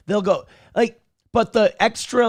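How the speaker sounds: normal talking voice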